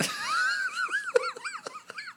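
a high-pitched squeal of a laugh. Real, not acted. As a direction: let out a long high squeal. Laughing at memory.

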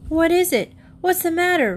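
high, startled cry of alarm; suddenly frightened, calling out. Acted. Rebecca startled by animal commotion.

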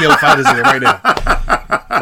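high-pitched order breaking into laughter; laughing retold demand. Teller rallying friends to find Remy, laughing. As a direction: laugh through a mock urgent command.

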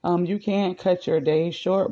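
normal talking voice

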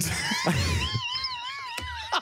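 high shrieking laugh. Real, not acted. Big laugh after fun.

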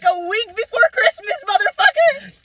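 high-pitched indignant outburst; rising, incredulous cry ending high; outraged. Real, not acted. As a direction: let the voice climb in outrage. Outraged at the retold remark.

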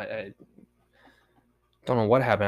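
ordinary talk, nothing marked

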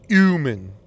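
"human" said "yooman", no H; H dropped. Retold or imitated. Imitating a college professor, "human" said as "yooman". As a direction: leave the H off "human". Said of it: "dropping the H".

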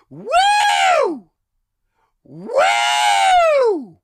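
shrieked high woo, arms up; mock party woo. Comic imitating a heckler's piercing woo. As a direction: imitate the drunk lawyer's woo. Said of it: "would go".

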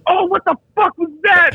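high, startled, disgusted shout; disgusted yell. Retold or imitated. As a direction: jolt awake to a stink. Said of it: "Groves goes".

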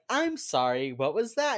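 loud, rising mock astonishment; mock disbelief. Husband pounces on wife admitting she was wrong, mock disbelief. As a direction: act out a teasing "come again?". Acted gloating demand to repeat admission.

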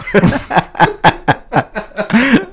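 high, extended laughing fit; helpless laughter. Real, not acted. Reacting to an even worse band joke, helpless laughter. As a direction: burst out laughing.

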